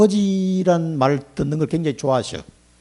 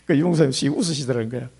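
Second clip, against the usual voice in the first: normal talking voice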